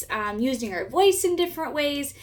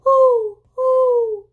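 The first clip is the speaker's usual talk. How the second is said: owl hoot; 12 semitones higher, 8 dB louder than usual. Shown as a demonstration. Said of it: "Owls hoot".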